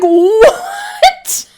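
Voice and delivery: very high, loud mock disbelief; shocked yell. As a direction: yell it like a stunned crowd. Retold reaction of whole table.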